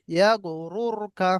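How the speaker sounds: very slow, every syllable drawn out; slow recitation. Shown as a demonstration. Teacher reciting the example slowly for students. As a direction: draw out yeah গরুরকা syllable by syllable. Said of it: "তারপরে দেখেন".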